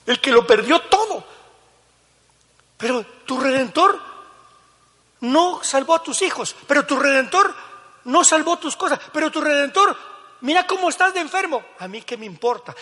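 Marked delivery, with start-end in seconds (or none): 0.1-1.3 higher, louder repeat. Real, not acted. Preacher repeats line louder. 2.8-11.6 high, loud preaching cry; full-force preaching. Acted.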